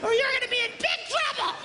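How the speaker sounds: high, fast sing-song nagging; wife's chatter. Retold or imitated. Man imitating his wife from the dryer, high and fast. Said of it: "had a guy the other day says".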